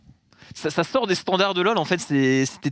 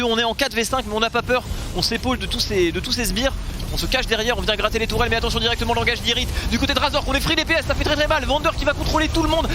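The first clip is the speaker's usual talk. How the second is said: fast, rising play-by-play; esports caster; 6 semitones higher than usual. Real, not acted. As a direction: build the call up to a shout. Live play-by-play fight call. (commentateur esport qui s'emballe)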